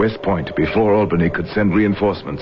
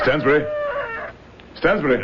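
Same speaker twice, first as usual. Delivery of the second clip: calling out, rising higher; 10 semitones higher than usual. Acted. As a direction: shout the name to find him. Calling for missing servant.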